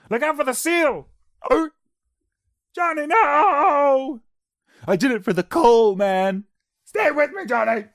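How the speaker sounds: high-pitched, loud screaming; hammed-up desperate cries to a dying mate; melodramatic distress. Acted disaster scene in mock trailer.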